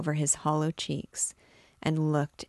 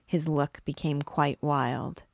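usual speaking voice

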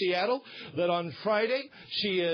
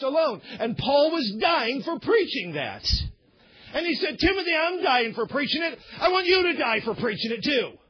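high, forceful pulpit preaching; impassioned preaching; 7 semitones higher than usual. Real, not acted. Preacher insisting to congregation on Christ alone, impassioned. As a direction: drive each point home in a raised preaching voice.

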